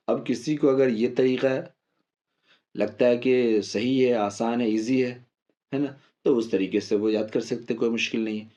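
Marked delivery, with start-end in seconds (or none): none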